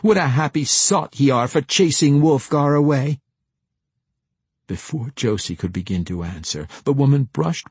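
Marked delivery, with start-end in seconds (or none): 0.0-3.2 high-pitched, scornful accusation; accusing. A narrator's reading. Deli blaming Josie for chasing Wolfgar away, accusing. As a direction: fling it at him accusingly. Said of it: "she accused".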